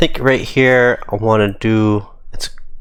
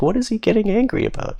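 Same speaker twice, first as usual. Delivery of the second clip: quizzical teammate; 14 semitones higher, 3 dB quieter, faster than usual. Retold or imitated. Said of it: "Fee turns around and is like".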